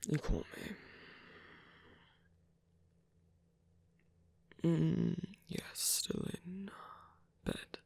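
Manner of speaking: unvoiced, sheepish whisper; tired whisper. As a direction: admit it in a drained whisper. Caught lying in bed unwell.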